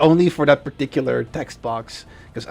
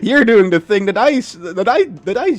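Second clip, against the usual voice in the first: excited; 8 semitones higher, 9 dB louder than usual. Real, not acted. Excited praise of viewer.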